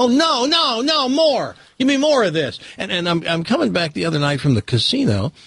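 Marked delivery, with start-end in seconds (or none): 0.0-2.6 high, pleading cry of a hooked viewer; begging for more. Retold or imitated. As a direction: whine it like a fan who can't stop watching.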